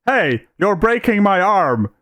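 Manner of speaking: high, slow mock complaint; mocking imitation. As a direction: parrot her whiny protest mockingly. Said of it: "Have you ever heard someone say".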